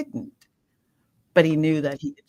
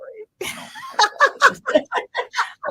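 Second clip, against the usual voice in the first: a long, high, ringing laugh; 5 dB louder than usual. Real, not acted. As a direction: laugh it off with relief. Laughs after finishing her story.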